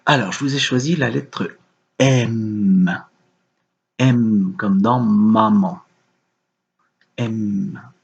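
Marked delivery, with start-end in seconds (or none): 2.0-5.8 M hummed long, "maman" slowed; drawn-out letter. Shown as a demonstration. Teacher helping a child hear the letter, lingering on M.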